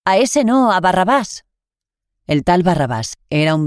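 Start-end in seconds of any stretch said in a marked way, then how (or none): 0.0-1.4 raised crowd cry, pitch higher; mild mock crowd shout. A narrator's reading. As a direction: call it out as the crowd. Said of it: "Volvieron a gritar".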